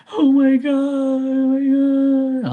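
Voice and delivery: high, very slow wailing oh my God; mock panicking parents. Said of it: "they make something small so huge".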